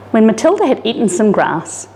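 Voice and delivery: plain reading voice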